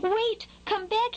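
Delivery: high-pitched anxious calling; little bird pleading after someone leaving; worried. A narrator's reading. Skylark calling after the departing worm, worried. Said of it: "with a worried tone in his voice".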